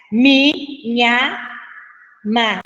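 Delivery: "Mi, nha, ma" as separate syllables with pauses; scanning syllables. Shown as a demonstration. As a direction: count out "Mi, nha, ma" one beat at a time. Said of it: "eu só conto, pessoal, até a última sílaba tônica".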